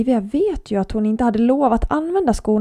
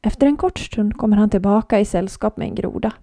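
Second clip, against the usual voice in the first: usual speaking voice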